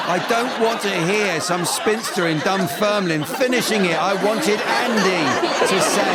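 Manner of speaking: loud, high-pitched comic scolding; mock exasperation. Host rebukes a heckler for finishing Andy's rhyme, mock exasperated.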